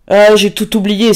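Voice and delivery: high, loud rapturous cry. A narrator's reading. Pauline cries out to her waking lover, high and loud. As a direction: burst out with joy at seeing him. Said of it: "s'écria-t-elle".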